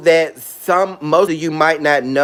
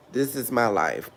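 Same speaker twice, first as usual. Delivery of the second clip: much softer, lower this is my life; soft echo; 4 semitones lower, 9 dB quieter than usual. Real, not acted. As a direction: let it fall away, almost to yourself.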